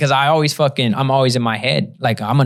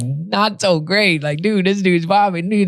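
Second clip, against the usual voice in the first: high, slow mocking drawl; mock commenter; 6 semitones higher, slower than usual. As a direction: read the comments like a sneering viewer.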